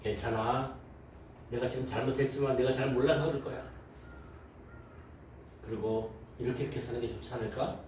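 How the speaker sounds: low, soft and kind coaxing; gentle admonishing. Retold or imitated. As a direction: soothe them as you correct.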